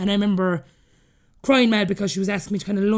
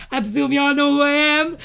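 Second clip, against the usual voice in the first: high, loud, haughty supermodel strut voice; mock Naomi Campbell diva; 6 semitones higher, 4 dB louder than usual. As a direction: play the grand diva who expects to be recognised. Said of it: "I'm Naomi Campbell right now".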